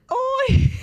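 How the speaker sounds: retold high squealed hi; excited hello. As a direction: greet a surprise guest with a shriek of joy. Her own reply on live.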